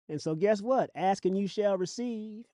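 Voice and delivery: usual speaking voice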